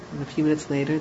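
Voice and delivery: normal talking voice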